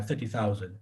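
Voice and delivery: normal talking voice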